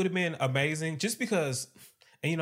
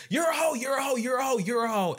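high mock yell of hoe over and over; mock shouting; 10 semitones higher than usual. Retelling a housewife's meltdown, mock shouted and high. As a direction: yell it like a woman mid-meltdown. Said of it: "She said".

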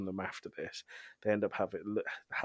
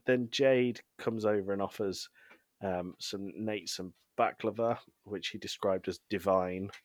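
ordinary delivery

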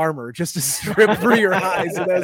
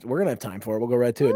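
ordinary talk

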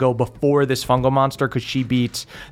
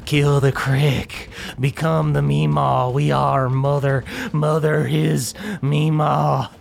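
fungal monster voice; 2 semitones higher than usual. Acted. Fungal monster threatens Moonshine before the fight.

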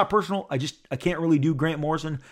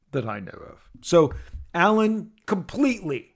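ordinary delivery

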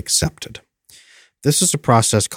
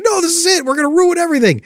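much higher, mock panicked cry; panicking brain. Anxious brain freaking out at the listener, high mock panic. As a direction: squeal it out like the brain in full alarm. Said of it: "your brain will freak out and say".

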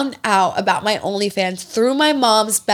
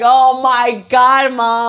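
put-on exasperated teen; 3 semitones higher, 7 dB louder, much slower than usual. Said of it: "I was like".

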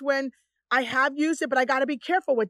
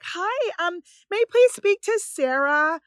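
higher, careful polite caller; polite phone voice; 4 semitones higher, 3 dB louder than usual. Retold or imitated. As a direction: put on a polite phone-call voice.

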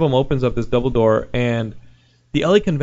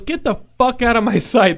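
mock furious worker; 9 semitones higher than usual. Worker ordering Tommy away, high and furious. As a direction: throw him out. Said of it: "he goes".

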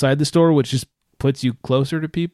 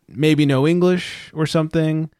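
normal talking voice

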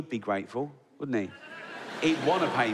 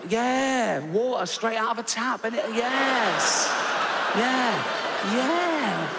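proud showing off; 6 semitones higher, slower than usual. Acted. Rich man showing his adopted boy tap water, high proud gush. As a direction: sell the tap like a miracle.

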